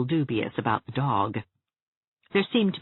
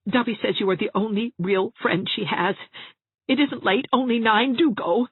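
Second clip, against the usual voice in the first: insistent plea, pitched up; Jarvis pleading urgently; 7 semitones higher, 4 dB louder than usual. A narrator's reading. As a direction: beg a friend not to fail you.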